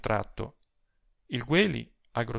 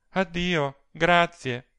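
higher-pitched, sighing goodbye; sighed farewell; 6 semitones higher, 5 dB louder, much slower than usual. A narrator's reading. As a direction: sigh out a resigned thanks. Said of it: "sospirò Fulvia".

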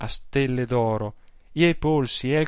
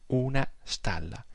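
hushed disbelief; 6 dB quieter than usual. Acted. Aunt repeats in disbelief.

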